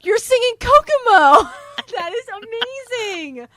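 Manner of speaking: high, loud cry of hilarity; laughing delight. Real, not acted. Host telling co-host she sang Kokomo, laughing in delight. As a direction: burst out through laughter. Cracked up by the mistake.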